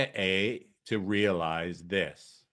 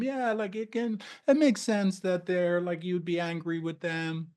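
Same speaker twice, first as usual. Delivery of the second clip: validating therapist; 7 semitones higher, 4 dB quieter than usual. Shown as a demonstration. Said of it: "you do that through validating them".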